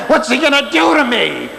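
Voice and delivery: high, hammed-up alarmed cry; lightly voiced frightened stranger; mock scared scream. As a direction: play the panicked stranger, half joking. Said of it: "they start screaming".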